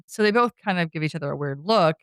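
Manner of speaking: ordinary delivery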